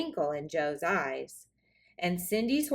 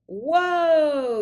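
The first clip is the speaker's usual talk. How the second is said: high, loud cowboy yell; 8 semitones higher, 9 dB louder than usual. Acted. Joe yells after the fleeing Cindy, high and loud. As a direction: holler after her as she runs. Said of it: "yelled Joe".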